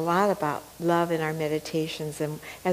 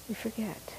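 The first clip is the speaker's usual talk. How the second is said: hushed echo; 2 semitones higher, 12 dB quieter than usual. Acted. Softer echo of previous words.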